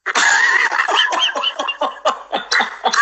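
bursts out laughing at her own story; a real, breathy laugh; laughing hard at the memory. Real, not acted. Laughs at the punchline.

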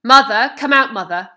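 loud shout calling across the yard. A narrator's reading. Father shouting for his wife across the yard, a loud call. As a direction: bellow the name out, falling at the end. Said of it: "He was calling out as he came".

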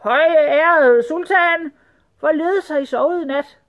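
high, loud, drawn-out salutation; servile rat. A narrator's reading. Rat greeting his honoured sultan, servile and effusive. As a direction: fawn slowly in a high rat voice.

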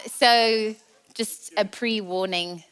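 ordinary talk, nothing marked

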